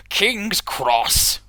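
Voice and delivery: snorting engine. Acted. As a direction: scoff it out loud and high. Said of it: "snorted the engine".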